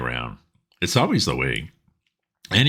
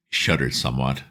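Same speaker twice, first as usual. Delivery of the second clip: plain reading voice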